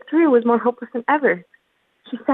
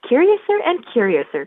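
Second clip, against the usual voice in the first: higher-pitched exclamation of amazement; surprised cry; 4 semitones higher, 4 dB louder than usual. A narrator's reading. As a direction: cry out in mild astonishment. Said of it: "cried Alice. She was so much surprised".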